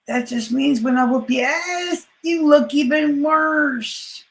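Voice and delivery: rising taunting yell; taunting shout. Real, not acted. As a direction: shout the threat right at him. Trash talk to opponent.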